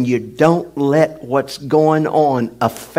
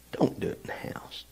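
fast unvoiced whisper; hurried whispered aside; 15 dB quieter, much faster than usual.

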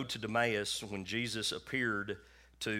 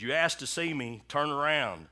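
loud, raised voice of God; big booming voice; 6 semitones higher, 6 dB louder than usual. Retold or imitated. God repeats his command to the man, big booming voice. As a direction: boom out the command, imitating God. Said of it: "he said, in the third time".

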